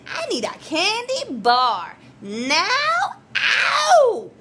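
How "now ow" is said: sudden high ow; sharp pained yelp. Acted. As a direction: yelp out in pain. Tooth cries out in pain.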